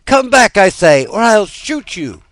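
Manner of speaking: high, loud threat with the bow; angry threat. A narrator's reading. Boy aiming his bow at the birds, loud angry threat. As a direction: order them down or else.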